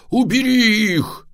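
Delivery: loud, high, stretched-out yell; panicked dad's command. A narrator's reading. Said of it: "орал папа".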